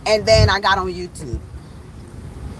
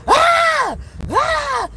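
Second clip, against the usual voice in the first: loud, very high mock scream; imitated scream; 4 dB louder than usual. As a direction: scream out "Ah!" like someone fleeing in panic. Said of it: "she ran and started screaming".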